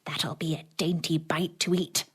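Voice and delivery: whispered to herself, greedy; muttered whisper. A narrator's reading. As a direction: hush it like a secret treat. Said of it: "she muttered to herself".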